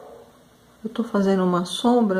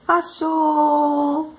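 retold shout; 5 semitones higher than usual. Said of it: "e me grita".

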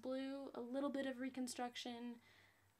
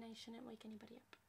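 quick, soft and hushed; hushed so as not to wake anyone; 2 semitones lower, 10 dB quieter, faster than usual. Real, not acted. Late at night, trying not to wake anyone, hushed. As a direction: keep it down, it's nighttime. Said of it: "because it's nighttime".

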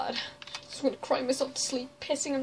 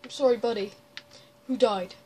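normal talking voice

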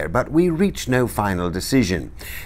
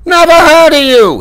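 high, loud dismissive shout; captain yelling scorn at a stranger; shouted reply; 10 dB louder than usual. Acted. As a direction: bellow it back across the water. Enemy captain shouts back.

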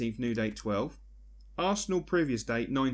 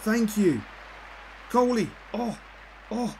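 higher, louder, urging the play on; excited calls; 9 semitones higher, 3 dB louder, slower than usual. Real, not acted. Gamer urging his players forward, excited. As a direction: call the pass as it happens. Attack building.

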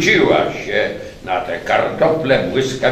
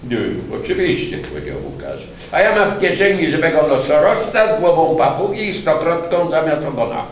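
sarcastic friend; 8 semitones higher, 5 dB louder than usual. A narrator's reading. Łukasz mocking disbelief.

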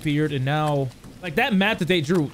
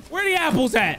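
usual speaking voice

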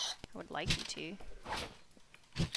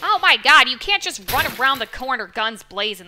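exasperated yell; 5 semitones higher, 21 dB louder than usual. Real, not acted. Enemy rushes around corner.

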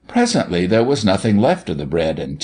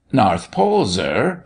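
ordinary delivery, nothing marked

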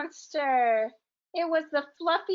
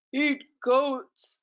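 a louder, lightly voiced hungry monster; fluffy monster; 2 semitones higher, 4 dB louder than usual. The fluffy monster wanting the goats, a louder hungry growl. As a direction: rumble it like a big hungry beast. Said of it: "said the fluffy monster".

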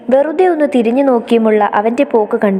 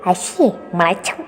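slow, softer lower groan of disgust; 4 semitones lower, 5 dB quieter, slower than usual. A narrator's reading. Brother disgusted at phone. (വെറുപ്പോടെ പതുക്കെ)

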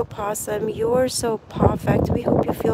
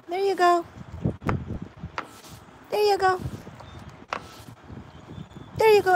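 puppy talk; 9 semitones higher, 3 dB quieter, faster than usual. Real, not acted. Handing treats to puppies.

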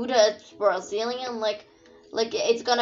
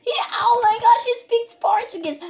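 high, loud, quick fangirl burst; excited squeal; 11 semitones higher, 4 dB louder than usual. Pretend. Player thrilled that José speaks Portuguese, high excited squeal. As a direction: squeal it out, pitched way up. Excited at finding a Brazilian character.

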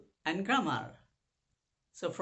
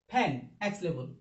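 ordinary talk, nothing marked

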